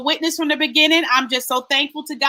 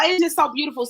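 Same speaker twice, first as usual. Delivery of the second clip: ordinary talk, nothing marked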